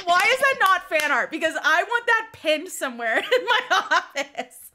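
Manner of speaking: laughing fangirl outburst; excited gush. Retold or imitated. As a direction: squeal it like a delighted fan. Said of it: "and I'm like".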